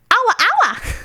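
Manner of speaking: high cartoonish shout; squeaky high call. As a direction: squeal it like a creature.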